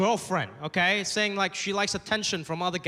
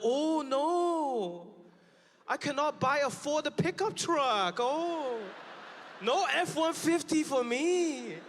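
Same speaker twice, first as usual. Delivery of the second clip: higher, mocking voice of an unbothered Chinese shopper; mock Chinese buyer; 6 semitones higher, slower than usual. Chinese buyer pretending to mourn the Ford truck, mock sad. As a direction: drag it out in a fake-sad put-on voice. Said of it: "they're like".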